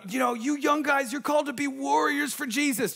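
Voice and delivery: mildly mock a clichéd call to young warriors; youth camp speaker.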